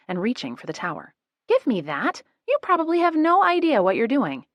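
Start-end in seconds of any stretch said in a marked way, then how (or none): 1.5-4.5 much higher and louder, demanding; snatch at it and shout; indignant shout. A narrator's reading. Grabbing for the computer tower.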